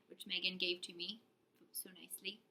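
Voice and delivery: hushed whispered aside; whispering. Real, not acted. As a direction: drop to a whisper.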